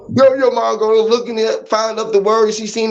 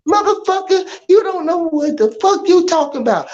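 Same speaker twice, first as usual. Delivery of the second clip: mom cussing him out, pitched much higher; mama scolding; 11 semitones higher than usual. Retold or imitated. Mama scolding her son, in a much higher voice. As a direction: play the fed-up mama snapping at her son.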